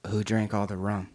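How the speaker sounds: lightly voiced pirate, pitched low; a hint of a low pirate impression; Jack Sparrow. Shown as a demonstration. Guest demos his pirate impression for the hosts, lightly voiced. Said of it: "my Jack Sparrow impression".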